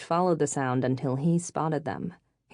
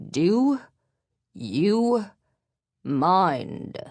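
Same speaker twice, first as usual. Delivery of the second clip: put-on indignant British accent, each word set apart; curt, spaced-out British rebuke; 4 semitones higher, 5 dB louder, much slower than usual. A narrator's reading. Infuriated passenger rebukes giggling women, indignant British accent. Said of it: "The Pierce Brosnan accent emerged".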